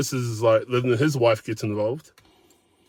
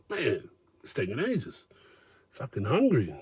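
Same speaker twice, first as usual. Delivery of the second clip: hushed grumble; 10 dB quieter than usual. Retold or imitated. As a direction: mutter it under your breath, starving. Muttering to his wife about food.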